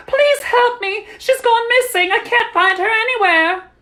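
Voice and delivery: shrieked, high hysterical plea; frantic cry. A narrator's reading. Mother begs crowd for help finding Clara, frantic. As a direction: beg loudly in rising panic. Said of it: "cried frantically".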